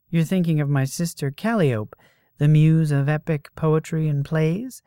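high, loud, breezy woman; brash muse voice. Acted. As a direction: play a cocky young goddess. Voices Calidora in the story.

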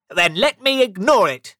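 high, loud dismissal; irritated retort. A narrator's reading. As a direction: shut the argument down crossly. Scrooge argues back.

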